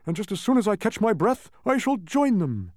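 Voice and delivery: high, strained humbug; high voice slowing at the end; weak, winded bug. A narrator's reading. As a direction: say it as if catching breath. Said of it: "announced the humbug weakly".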